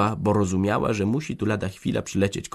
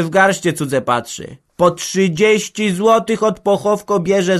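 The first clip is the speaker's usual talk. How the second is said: put-on grumbling old woman; 9 semitones higher, 8 dB louder than usual. Old woman rails at the organist's fees, griping. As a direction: complain about the greedy organist.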